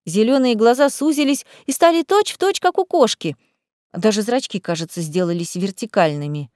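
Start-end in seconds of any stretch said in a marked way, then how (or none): none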